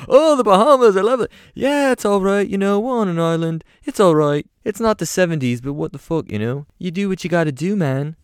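very high British voice; chatty and high, like an old pop star. Retold or imitated. [name] chats about the island.

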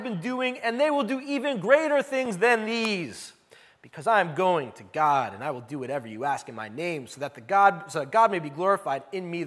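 preacher reading Jesus' words from the pulpit; scripture reading. Acted. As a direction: read the Gospel out to the congregation. Reads second scripture passage.

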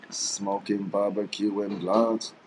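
sly slow whisper; whispered aside. Real, not acted. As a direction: whisper it slowly. Sneaky joke aside.

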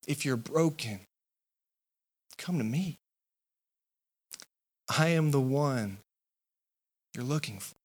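gentle invitation. Acted. Jesus answering the pleading crowd, softly inviting. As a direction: call the tired in quietly. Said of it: "Jesus steps up and says".

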